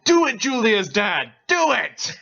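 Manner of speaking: high, loud goading shout; egging on. Pretend. Reader eggs on Julia's dad, goading shout. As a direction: cheer him on at the top of your pitch.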